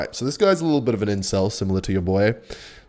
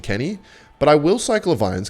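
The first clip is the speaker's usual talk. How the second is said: normal talking voice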